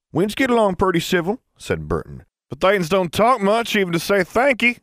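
teasing the dwarves within earshot; high, fast backwoods voice; folksy hillman. A narrator's reading.

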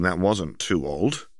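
plain reading voice

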